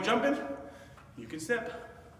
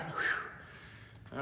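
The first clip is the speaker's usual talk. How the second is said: breathy whew; 9 dB quieter than usual. Real, not acted. Tired after burpees.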